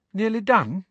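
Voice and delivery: a high, lightly voiced girl, tired near midnight; sleepy Hermione. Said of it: "Hermione wandered over to them, yawning".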